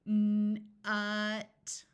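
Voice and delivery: sounds stretched apart; slow segmented word; said slowly. Shown as a demonstration. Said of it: "I'm going to say a word slowly".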